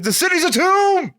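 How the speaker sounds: very high mock cry of doom; melodramatic wail. If the power ever fails, high mock wail. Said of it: "I'll have to be like".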